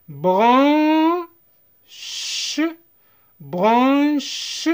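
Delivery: "Branche" drawn out as bran... che; syllable-by-syllable demo. Shown as a demonstration. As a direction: stretch the nasal vowel of "Branche", then release "che". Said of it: "A N se prononce en".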